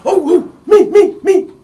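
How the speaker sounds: put-on very high, loud eager kid; excited little Johnny. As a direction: squeal to be picked from the crowd. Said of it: "Little Johnny out in the audience said".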